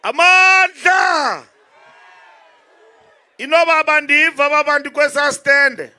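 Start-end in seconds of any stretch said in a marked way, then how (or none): 0.0-1.5 slow, high rally cry; ecstatic praise shouting. Real, not acted. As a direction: stretch it out to rouse the stadium. Rally call to the crowd.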